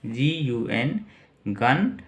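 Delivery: normal talking voice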